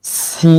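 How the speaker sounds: সি with a long, thin "sss" before the vowel; drawn-out hissed "s" in সি; s sound of সিন. Shown as a demonstration. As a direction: hold a sharp "s" at the start of সি. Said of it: "এরকম একটা শব্দ হবে".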